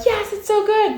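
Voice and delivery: high delighted squeal; excited. Retold or imitated. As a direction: squeal with sudden relief. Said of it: "We're like".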